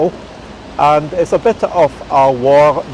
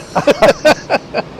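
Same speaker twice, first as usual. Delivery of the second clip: high breathy laugh; 12 semitones higher than usual. Real, not acted. Laughing at weather joke.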